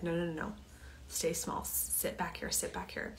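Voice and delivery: hushed whisper, pulling herself back; inner voice whisper. Retold or imitated. Inner part of her urging herself to stay small, whispered. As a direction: breathe it out like a frightened inner voice.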